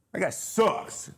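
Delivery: slow, high teasing jab at a pal; mock trash talk. Host jokingly disses the other pitcher, slow mock scorn. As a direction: drag it out, playfully dissing him. Joking about a rival's speed.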